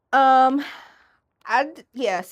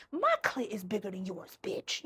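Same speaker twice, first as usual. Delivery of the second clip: ordinary talk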